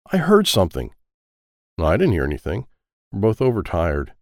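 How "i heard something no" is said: ordinary delivery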